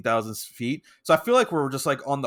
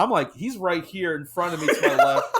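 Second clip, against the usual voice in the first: ordinary delivery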